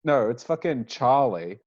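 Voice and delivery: ordinary delivery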